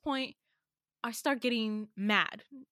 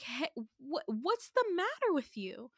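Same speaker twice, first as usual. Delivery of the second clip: shocked; 6 semitones higher than usual. Retold or imitated. Narrator to A after the slam, high shocked. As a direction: pitch jumps high in disbelief. Shocked when A slams stuff down.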